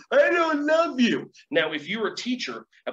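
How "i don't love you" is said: put-on high, loud, slow child voice; sulky kid declaring it, slowly; child's hurtful words. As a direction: say it like a defiant little kid. Child rejecting the teacher.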